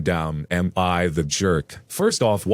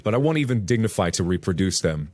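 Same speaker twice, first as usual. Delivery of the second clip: ordinary delivery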